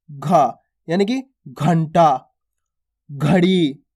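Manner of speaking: घा with a breathy aspirated gh, slow and low; aspirated demo. Shown as a demonstration. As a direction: drag out घंटा with a strong aspirated घ. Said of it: "थोड़ा जोर लग रहा है".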